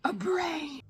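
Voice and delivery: high, loud exclamation of surprise; amazed. Real, not acted. Viewer reacting to the cloud's brain, amazed. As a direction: cry it out in astonishment.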